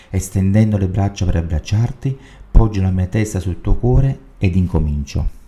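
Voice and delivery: loud, devout prayer read out; loud devotional prayer reading; fervent prayer. Acted. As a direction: pray it aloud to Jesus, full voice. Reads an opening prayer aloud.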